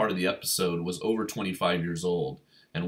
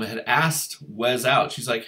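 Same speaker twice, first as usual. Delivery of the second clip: usual speaking voice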